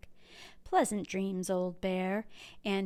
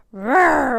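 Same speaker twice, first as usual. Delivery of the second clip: loud growling bear roar; a booming animal growl; 4 semitones higher, 10 dB louder than usual. A narrator's reading. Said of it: "Old Bear growled".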